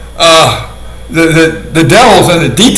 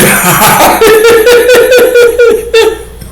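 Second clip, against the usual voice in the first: high long laugh. Real, not acted. Laughing at his own dark joke, high cackle.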